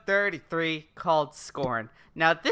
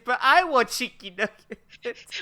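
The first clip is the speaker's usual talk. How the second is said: mock-childish whine; whiny baby; 5 semitones higher, 3 dB louder than usual. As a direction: plead like a spoiled toddler. Joins in the baby bit.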